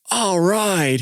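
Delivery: high, excited cheer. Retold or imitated. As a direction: cheer it like a thrilled fan. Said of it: "He's all excited".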